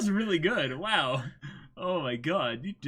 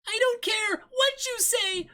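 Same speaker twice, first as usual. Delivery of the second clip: very high, slow, rising to a shout; squeaky battle cry; Ruby Rose voice; 4 dB louder, slower than usual. Acted. Friend doing Ruby's defiant line, squeaky high shout. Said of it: "getting the pitch".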